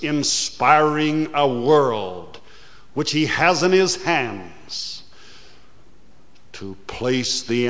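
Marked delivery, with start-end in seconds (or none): none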